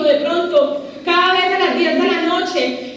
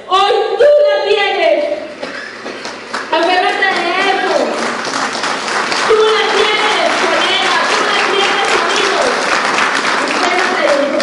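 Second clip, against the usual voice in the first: rallying shout; 5 semitones higher, 4 dB louder than usual. Real, not acted. Speaker rallying colleagues in the audience, loud. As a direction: call out to the crowd to fire them up.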